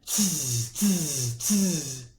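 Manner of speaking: soft tutting monkey; monkey tsk. A narrator's reading. Monkeys mock the peddler with quiet tutting. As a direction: click back at him like a monkey. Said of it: "and said".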